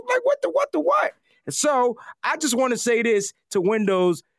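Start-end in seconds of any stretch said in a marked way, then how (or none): 0.1-1.1 high, disbelieving shout; what-the-what yelp, way up high; stunned outburst. Real, not acted. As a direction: yell it at the broken computer. Sound effects stop working mid-show.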